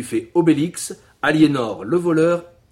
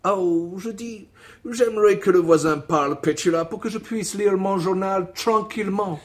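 put-on English accent; 6 semitones higher than usual. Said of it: "le voisin avec l'accent anglais".

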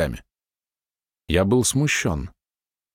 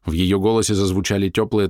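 plain reading voice

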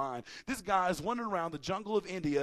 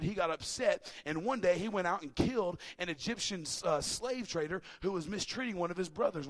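ordinary delivery

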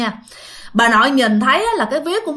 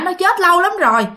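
scolding; 6 semitones higher than usual. Retold or imitated. Grandfather scolds grandmother over the ghost idea, raised voice. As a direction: snap her out of the ghost talk. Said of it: "Ông nội mới la".

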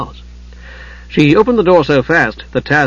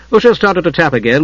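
usual speaking voice

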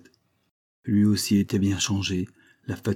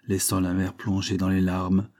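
ordinary delivery

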